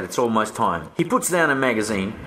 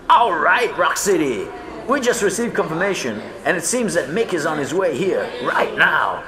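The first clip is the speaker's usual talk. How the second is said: crowd announcement; 5 semitones higher than usual. A narrator's reading. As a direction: hype up the room.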